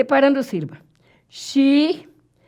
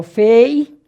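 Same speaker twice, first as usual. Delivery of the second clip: FEI punched louder and held long; stressed diphthong; 7 dB louder than usual. Shown as a demonstration. Teacher points out the diphthong to students, "Fei" loud and drawn out. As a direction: lean hard on the diphthong in "Fei". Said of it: "depois desse ditongo".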